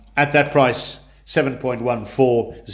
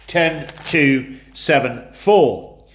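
each number spaced out slowly; slow and deliberate; 2 semitones higher, much slower than usual. Shown as a demonstration. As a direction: spell out the numbers slowly for clarity. Repeats combination for clarity.

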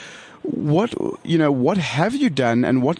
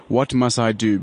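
ordinary talk, nothing marked

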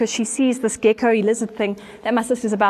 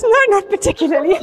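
high, loud protest; emphatic comic denial; 9 semitones higher, 5 dB louder than usual. Retold or imitated. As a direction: deny it loudly for laughs.

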